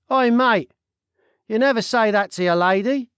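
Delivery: nosy Cockney stranger butting in; loud, cheeky Cockney voice; Cockney accent. Acted. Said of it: "said the Cockney man".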